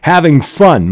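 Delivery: high, sharp jab of a mild shout; mock shout. Mother shouts at her daughter, a mild mock shout. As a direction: fling it like a mother's accusation. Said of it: "Mom shouts".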